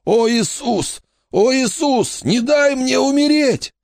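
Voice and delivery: very high, strained cry of a dying man; high-pitched, panicked pleading; desperate begging. A narrator's reading. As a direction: beg in terror not to die. Dying man begs for his life.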